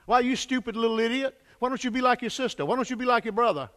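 put-on a parent snapping insults at a child; mock scolding parent. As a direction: scold the kid, quick and cutting. Parent cursing child, acted.

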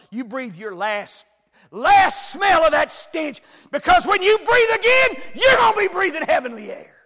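high, loud, impassioned sermon voice; preacher at the peak of his sermon; full-force preaching. Acted. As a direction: thunder it out to the congregation. Preaching climax again.

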